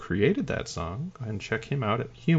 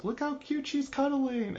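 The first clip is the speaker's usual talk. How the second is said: cutesy mock voice; 5 dB quieter than usual. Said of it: "you've taken other pictures".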